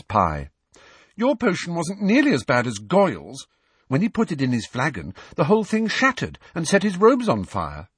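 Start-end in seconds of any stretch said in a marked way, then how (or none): 1.2-7.9 Hermione voice. A narrator's reading. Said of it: "said Hermione consolingly".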